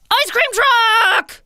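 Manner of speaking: high, loud, slow yell; a boy shouting news of the ice cream truck; excited shout. A narrator's reading. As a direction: shout it through the house.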